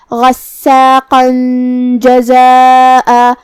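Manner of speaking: غساقا جزاء with the nun hidden in a nasal hum, very slow; ikhfa with ghunna. Shown as a demonstration. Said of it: "تنوین کو چھپاتے ہوئے اور غنہ کے ساتھ". (غساقا جزاء میں تنوین چھپا کر غنہ کے ساتھ)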